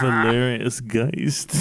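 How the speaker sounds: slow, low theatrical self-introduction; Valerius Geist. Acted. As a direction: announce the name like a lurking ghost, drawn out. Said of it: "Who's, who said that?".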